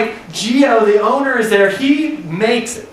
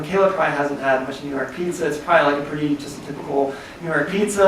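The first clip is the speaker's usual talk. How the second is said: low, quick aside under the breath; muttered skeptical thought; 6 semitones lower, 5 dB quieter, faster than usual. Retold or imitated. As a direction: mutter it to yourself, unconvinced. His own skeptical inner thought.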